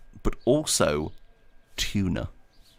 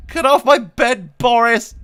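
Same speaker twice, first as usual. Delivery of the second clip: high, loud, drawn-out telling-off; scolding shout; 8 dB louder, slower than usual. Real, not acted. Owner shouts at cat Boris on the bed, high and loud. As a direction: shoo the pet off the bed, loudly.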